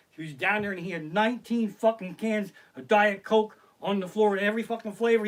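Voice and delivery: usual speaking voice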